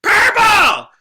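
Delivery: sudden high piercing yell; screamed out. Retold or imitated. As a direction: scream the word out over the show. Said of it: "screams out".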